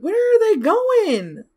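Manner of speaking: high drawn-out confusion; surprised squeal. Real, not acted. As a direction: stretch it out in disbelief. Puzzled at characters.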